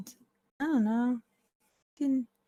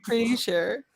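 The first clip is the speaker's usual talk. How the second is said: teasing echo; 6 semitones higher, 7 dB louder than usual. Pretend. Teasing echo of panicked player.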